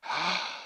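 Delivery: quick soft gasp of wonder; soft amazed gasp. Acted. Shaman Dog amazed at President Dog's words, a soft gasp. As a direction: gasp in awe, barely voiced.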